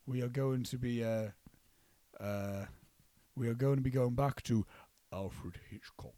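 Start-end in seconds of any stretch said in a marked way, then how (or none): 5.1-6.1 low, slow spooky drawl. Pretend. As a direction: sink it low and eerie. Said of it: "say it in a spooky voice".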